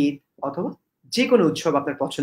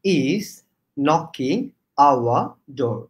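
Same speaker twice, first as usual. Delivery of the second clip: very slow, separated words; teacher's word-by-word model; easy pronunciation demo; much slower than usual. Shown as a demonstration. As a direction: leave a gap after each word for learners. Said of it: "আমি একটু সহজ করে শিখিয়ে দিই".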